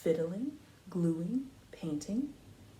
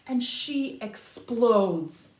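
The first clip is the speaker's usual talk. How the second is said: louder and slowed right down; dramatic explosion; 8 dB louder, much slower than usual. A narrator's reading. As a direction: let the word explode, slow and loud. Girl loses temper in story.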